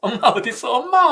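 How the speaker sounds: mock child calling, rising to a high cry; a lost five-year-old crying out for mom. Preacher reenacting a lost child calling for mom, mildly. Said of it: "있는 힘껏 목청껏 외쳤던".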